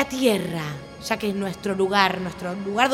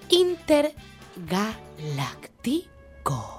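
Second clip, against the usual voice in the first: slow, stretched dramatic delivery; drawn-out announcer word; 3 semitones lower, 6 dB quieter, much slower than usual. Shown as a demonstration. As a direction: draw the word out slowly. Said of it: "¿Cómo, cómo, cómo, cómo?".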